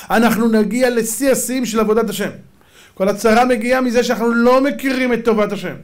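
high, loud sermon delivery; impassioned preaching. Real, not acted. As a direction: preach it at full force, pitched high. Preaches at full force.

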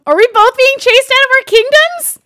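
loud, high panicked question; being chased. Real, not acted. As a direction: blurt it out in alarm. Chased by invisible whisperer.

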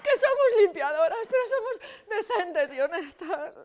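high, pleading objection; wounded protest. Acted. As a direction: whine it in offended decency.

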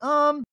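high, loud drawn hesitation; long hesitant um. Retold or imitated. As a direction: stall with a big high um. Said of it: "and then pauses".

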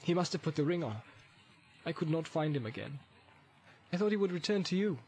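hushed, guarded Boromir voice; hesitant answer. A narrator's reading. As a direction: confess it quietly, with pauses. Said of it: "He answered slowly".

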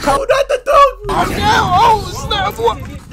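high, loud shocked shouting; shocked laughter. Real, not acted. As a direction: cry out, laughing in horror. Reacting to the video.

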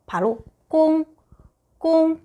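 꽁 held high and level as gōng; Mandarin gōng. Shown as a demonstration. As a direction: say 꽁 on a flat high first tone. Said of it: "무슨 소리 나올 수 있어요". (꽁을 높고 평평한 1성으로)

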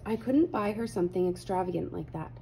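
plain reading voice

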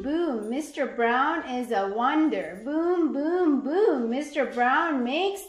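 ordinary talk, nothing marked

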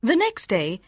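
usual speaking voice

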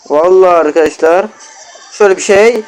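usual speaking voice

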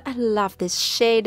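ordinary talk

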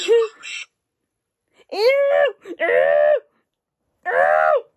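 loud, squealing cries of disgust; disgusted shriek. Real, not acted. Disgust at the pet's belly.

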